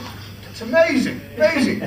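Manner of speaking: high, rising delight in an imitation of the Queen. The Queen admiring the kick, high and delighted. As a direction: pitch it way up, marvelling.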